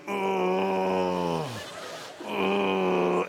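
long imitated moan of pain. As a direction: groan steadily through kidney-stone pain. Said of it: "I'm in pain, I'm like going".

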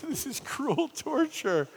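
high, slow anguished moan; mock anguish. Alone in room, anguished.

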